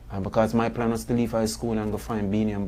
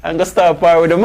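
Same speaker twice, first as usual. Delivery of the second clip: louder, laughing through the words; 7 semitones higher, 8 dB louder than usual. Real, not acted. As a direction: chuckle while recalling the plan. Laughing about his old plan.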